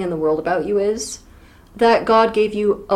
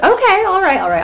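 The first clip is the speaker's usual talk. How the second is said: exasperated; 11 semitones higher, 4 dB louder than usual. Real, not acted. Conceding mock exasperation.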